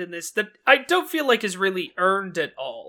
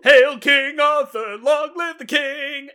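high, slow, drawn-out acclaim; hammed-up royal hailing call; mock crowd cheer; 7 semitones higher, 5 dB louder, slower than usual. Acts the film's shouting crowd.

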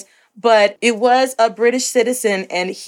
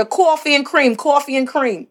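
mock New York accent; 5 semitones higher than usual. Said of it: "of a New York accent".

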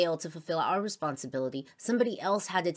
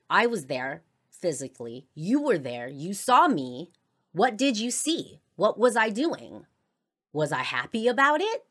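retold heated accusation; 5 semitones higher, 7 dB louder than usual. Retold quote from a huge argument.

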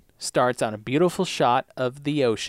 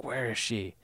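soft, barely stirring patient; under his breath; 9 dB quieter than usual. Retold or imitated. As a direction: imitate murmuring it under your breath. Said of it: "sort of under his breath".

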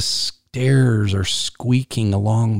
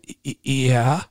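stammered, higher-pitched, frightened yeah; very shaky voice; 5 semitones higher than usual. Retold or imitated. Scared first grader answering brother on the phone, very shaky voice. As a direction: imitate a scared child stammering out agreement. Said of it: "in my very shaky voice".

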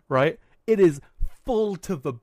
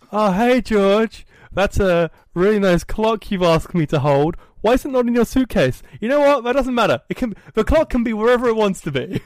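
high, louder, overly sweet placating voice; nervous parents; 6 semitones higher, 5 dB louder than usual. Retold or imitated. George voicing his parents too scared to ask, high placating. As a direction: sing it out like scared, appeasing parents.

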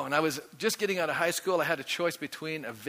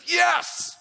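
1 excited cry; 14 semitones higher, 6 dB louder than usual. Real, not acted. Exclamation at the congregation.

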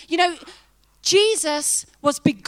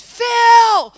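a full-lunged yell of the husband's name; shouted name; 8 semitones higher, 13 dB louder than usual. Retold or imitated. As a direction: call him down from upstairs at the top of your lungs. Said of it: "I shouted".

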